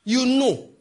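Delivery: high, slow, loud ringing cry; shouted rebuke. Real, not acted.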